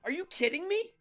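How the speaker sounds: high, loud amazed outburst; genuinely floored by the news; real shock. Real, not acted. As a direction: blurt it out in disbelief. Reacting to surprising chat news.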